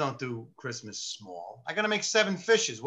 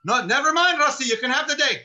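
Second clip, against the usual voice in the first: high, loud imitation of an exasperated boss; flustered giving-in; 10 semitones higher, 9 dB louder than usual. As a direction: cave in with a mock outburst. Flustered lieutenant backs down.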